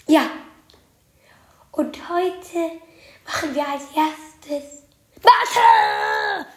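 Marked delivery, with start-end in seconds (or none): 1.7-6.5 silly clown. Acted. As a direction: announce it like a goofy clown. Teacher Pam announces math.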